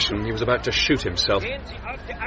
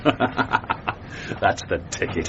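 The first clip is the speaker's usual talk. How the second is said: laughing; 4 dB quieter, much slower than usual. Acted.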